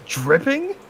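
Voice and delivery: indignant high voice. Acted. Playing offended Homer.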